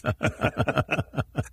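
a short, airy, unvoiced laugh. Real, not acted. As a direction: let a light chuckle slip out. Laughs at his own story.

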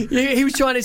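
breaks into laughter mid-sentence; laughing. Real, not acted. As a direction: laugh while talking. Teller laughs at own story.

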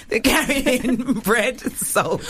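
laughing through the words. Real, not acted. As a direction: giggle while rereading, slow. Rereading her misread line laughing.

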